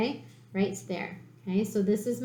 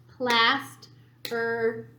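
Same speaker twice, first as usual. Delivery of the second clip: Plastering drawn out slowly, syllable by syllable; clapped syllables; much slower than usual. Shown as a demonstration. Teacher claps the word for the class, slow and syllable by syllable. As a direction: clap out Plastering slowly, one beat per syllable. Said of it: "let's clap plastering".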